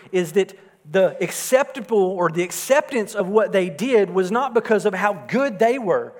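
emphatic sermon voice; preaching. Acted. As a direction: drive the point home from the pulpit. Preacher builds to emphatic point.

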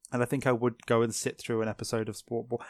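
normal talking voice

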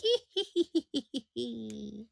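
high witch cackle. Shown as a demonstration. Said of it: "I'm like".

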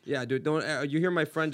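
ordinary talk, nothing marked

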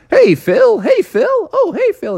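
high, cheery calls; townsfolk greeting. Acted. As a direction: call out hello to a local celebrity.

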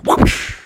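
unvoiced swoosh effect; breathy cartoon whoosh; imitated whoosh. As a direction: make a whispery whooshing noise. Noise of pulling out pencil.

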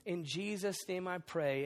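hushed, reverent pastor's prayer; quiet congregational prayer, gently led; soft prayer voice. Acted. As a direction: pray softly over the room. Leads the opening prayer.

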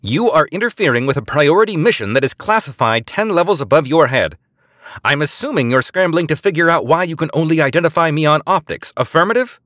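high, loud, authoritative near-shout. A narrator's reading. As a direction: lean hard on the officer. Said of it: "Eliza nearly shouted".

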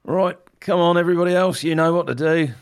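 high, loud-edged battle orders; Griff rallying allies. Acted. Griff directs Elmo and the others, calling out orders. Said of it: "Griff wants Elmo to".

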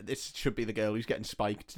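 low, flat quoting back; quiet deadpan repeat. Retold or imitated. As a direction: repeat it quietly, pointing out how bad it sounds. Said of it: "It didn't sound great".

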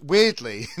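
usual speaking voice

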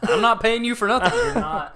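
put-on high, loud country-accent protest; hammed-up country accent. Homeowner refusing to pay, loud country accent. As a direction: protest loudly in a country twang. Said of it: "went through so many different accents".

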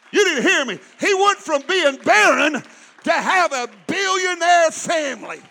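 loud preached scripture; full-force sermon reading; preaching. Acted. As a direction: declaim it to the congregation. Climactic preaching.

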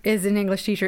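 ordinary delivery

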